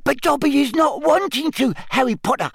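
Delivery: high elf voice back to normal; chatty little elf; house elf voice. Acted. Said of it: "talking normally again".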